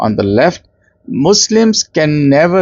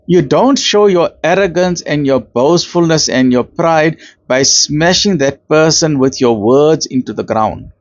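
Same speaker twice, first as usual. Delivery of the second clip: ordinary delivery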